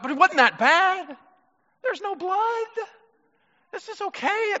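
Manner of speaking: high, whiny self-excuse; excusing himself. Retold or imitated. Preacher mimics his own excuses to God, high and whiny. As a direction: plead your case like a kid. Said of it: "my instant response is like".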